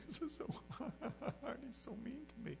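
high, soft, slow mock whimper with a laugh; mock whine. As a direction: pretend self-pity to friends. Whining to friends, laughing.

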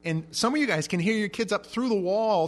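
ordinary talk

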